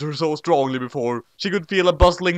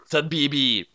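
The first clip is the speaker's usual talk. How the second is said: ordinary delivery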